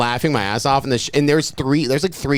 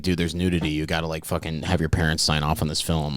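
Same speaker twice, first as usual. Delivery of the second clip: much softer, low conspiratorial hype; hushed teaser; 9 semitones lower, 6 dB quieter than usual. Retold or imitated. Teacher teases a movie to his summer class, hushed. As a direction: lean in and hush it like a secret. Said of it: "it was like".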